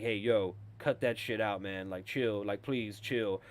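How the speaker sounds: low, soft, calming tone; calm Joel. Retold or imitated. Joel soothing the old man, low and soft. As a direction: talk him down gently, low. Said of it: "he's like".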